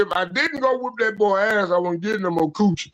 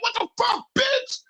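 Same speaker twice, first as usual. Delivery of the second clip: high mock yell in disbelief; retold shocked yell. Retelling a fight, he yells in high mock disbelief. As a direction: yell it high, stunned at being hit. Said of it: "I'm like".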